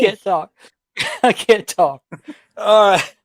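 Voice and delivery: gasping for air after laughing; can't get words out between laughs; breathless from laughing. Real, not acted. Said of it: "Mark's gotta catch his breath".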